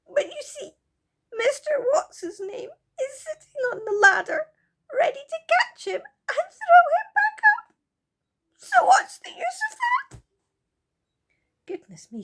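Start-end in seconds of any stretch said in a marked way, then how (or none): 0.1-10.2 high, loud sobbing little fairy. Acted. Silky sobs to the children about Moonface, high and tearful. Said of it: "said Silky".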